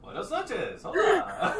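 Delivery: high greeting breaking into a laugh; high, laughing sheepish hello. Acted. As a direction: chuckle through the hello. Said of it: "Lupe looks up and goes".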